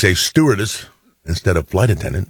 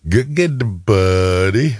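Pauly Shore; 3 semitones higher, much slower than usual. Retold or imitated. Host imitating a goofball in Encino Man, playful impression. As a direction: imitate a movie goofball's way of talking. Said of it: "the way he was talking".

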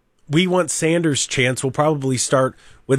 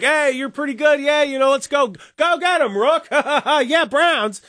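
much higher, cheering fan with laughs; excited fan; 10 semitones higher than usual. Retold or imitated. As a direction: play a giddy fan hyping the rookie. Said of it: "they're gonna be like".

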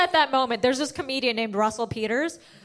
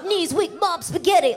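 higher-pitched hyped-up mock rap line; excited; 5 semitones higher than usual. Nervous teen comic with [name] in her head, excited. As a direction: psych yourself up with mock excitement. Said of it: "Like, I was so excited".

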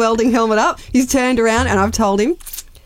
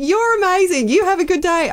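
gushing men; 11 semitones higher than usual. Retold or imitated. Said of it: "They went".

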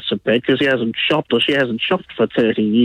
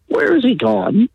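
higher, drawn-out questioning; mock-wonder slowly, pitched up; mock concerned caller; 9 semitones higher than usual. Host imitating listeners asking after him, mock concerned. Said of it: "then I notice you'd go".

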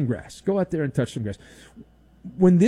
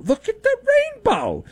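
put-on high, sing-song baby talk, much higher; mock kiddie voice. Host mocking spoon-fed fans, in high baby talk. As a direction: coo it like showing a toddler a rainbow.

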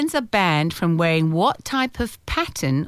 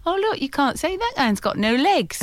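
joking; 8 semitones higher than usual. Retold or imitated. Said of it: "will say".

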